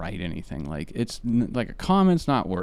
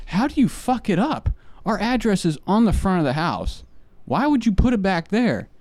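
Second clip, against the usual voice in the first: higher-pitched indignant questioning; exasperated complaint; 8 semitones higher than usual. Retold or imitated. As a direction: demand answers, pitched up in frustration. Acted complaint to the mailman.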